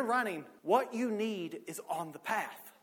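emphatic preacher building in force; preaching. Real, not acted. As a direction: drive the promise home to a congregation. Preaches at rising full force.

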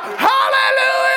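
very high, drawn-out full-voice shout; long, loud reenacted praise shout; jubilant shout. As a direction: let praise rip at the top of your voice. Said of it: "And then I just shout".

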